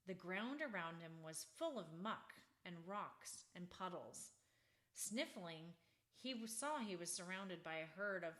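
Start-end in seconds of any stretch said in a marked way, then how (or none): none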